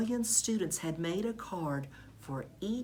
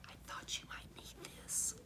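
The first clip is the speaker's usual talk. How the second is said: whisper; 13 dB quieter than usual. A narrator's reading. Teacher slips secret present.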